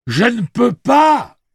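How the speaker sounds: slow, high, loud shout; cri. A narrator's reading. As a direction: shout each word slowly and forcefully. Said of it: "cria celui-ci". (cri lent et puissant)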